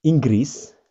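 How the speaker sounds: Inggris in Indonesian sounds, ING-gris; the Indonesian name, not the English one; wrong form. Shown as a demonstration. Teacher shows the Indonesian word first. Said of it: "itu bukan English".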